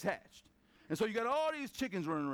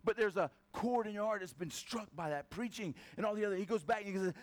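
normal talking voice

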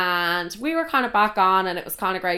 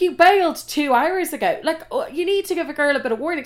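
retold scolding, higher-pitched and protesting; indignant retort; 6 semitones higher than usual. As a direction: tell him off with exasperation. Retells scolding him for bailing.

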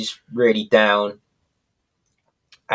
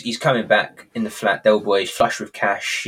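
ordinary talk

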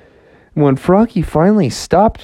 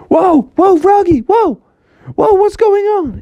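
high, louder startled whoas; alarmed friends; 5 dB louder than usual. Acted. Friends warning Froggy, high alarmed calls. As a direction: cry out in alarm.

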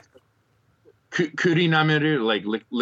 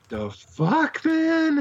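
mock bewilderment, pitch shooting up; retold high-pitched disbelief; baffled reaction; much slower than usual. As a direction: act out a stunned viewer, rising sharply. Acts out his baffled reaction.

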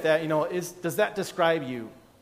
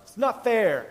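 mock whine; 7 semitones higher, slower than usual. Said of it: "the one I hear around my house".

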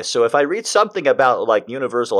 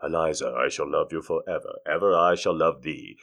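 low, soft romantic recital; solemn, softly intoned verse; poem reading; 7 semitones lower, 8 dB quieter than usual. Acted. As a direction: recite an old love poem, hushed. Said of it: "I'll be reading the poem".

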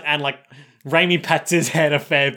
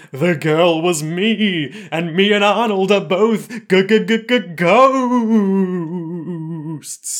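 spooky ghost reveal; 4 semitones higher, 4 dB louder, much slower than usual. Acted. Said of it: "Raimi is all like".

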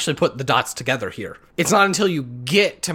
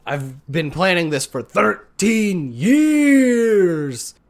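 slow, drawn-out villain complaint; mock Hades; 2 semitones higher, much slower than usual. Imitating Hades annoyed at the heroes, slow mock grievance. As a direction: whine it like a put-upon god. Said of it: "Hades is just like".

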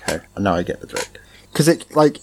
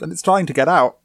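normal talking voice

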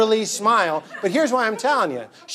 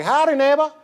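folksy higher-pitched Southern greeting; Southern accent; 5 semitones higher than usual. Acted. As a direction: greet like a cheery Southern neighbor. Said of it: "You're Southern all of a sudden".

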